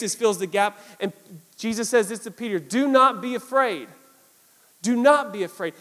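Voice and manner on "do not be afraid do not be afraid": emphatic reassurance. Retold or imitated. Jesus's words to Peter, retold.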